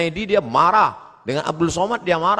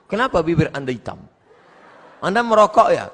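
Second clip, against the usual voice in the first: examiner's question; 4 semitones higher, 5 dB louder, faster than usual. Retold or imitated. Meniru penguji bertanya.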